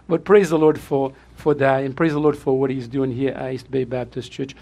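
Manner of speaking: normal talking voice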